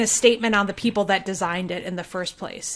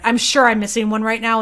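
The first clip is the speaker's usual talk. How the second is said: usual speaking voice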